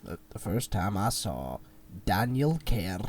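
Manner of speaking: imitating a slow, low, soft Scottish accent; teasing Scottish impression; mock Scottish accent. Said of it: "you went".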